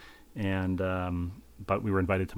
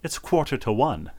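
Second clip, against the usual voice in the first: put-on a self-admittedly poor English accent, a little higher and louder; bad English accent; 5 semitones higher, 5 dB louder than usual. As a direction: try an English hostess voice and miss. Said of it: "I can't do the English accent well".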